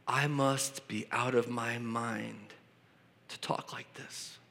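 slow, low-key, dropping almost to a whisper; hushed and halting, trailing into breath; hushed aside. Acted. Preacher reads Paul pausing over his own boast, hushed aside. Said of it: "And Paul pauses and says these words".

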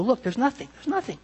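high, small, soft voice; lightly mock a thinker finding emptiness; mock discovery. Mimics deconstructionists finding nothing.

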